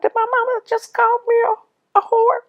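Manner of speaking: very high-pitched, stunned disbelief; outdone. Retold or imitated. Daughter recalling her mother's insult, high and outdone. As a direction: retell it in a high, incredulous voice. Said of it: "I was so outdone".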